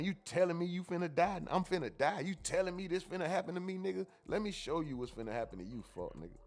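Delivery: a hint of a menacing father; menacing dad. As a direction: lean in and threaten him softly. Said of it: "real old school steppers".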